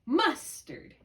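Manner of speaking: "Mustard" as MUS-terd, MUS high and strong; stress on first syllable. Shown as a demonstration. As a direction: punch the first syllable of "Mustard", drop the second. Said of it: "accent lands on the first syllable".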